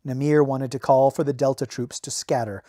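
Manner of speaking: usual speaking voice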